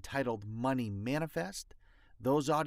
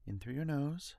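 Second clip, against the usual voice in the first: guided breathing meditation; 6 dB quieter than usual. Acted. Leads breathing exercise.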